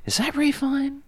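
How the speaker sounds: high, puzzled double take; surprised. Retold or imitated. Viewer spotting a familiar actor, high surprised question. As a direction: squint at the screen in disbelief. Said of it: "And I'm like".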